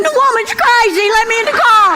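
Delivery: high, frantic plea for help; panicked plea. Retold or imitated. Beaten man begs the cops to let him in, high and panicked. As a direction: beg the cop like she is chasing you. Man running after cop car.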